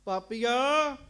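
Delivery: wife shouting at a suspected sinner; outraged cry. Acted. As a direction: cry out at the stranger. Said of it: "ਉੱਚੀ ਉੱਚੀ ਬੋਲਣ ਲੱਗ ਪਈ ਜੀ".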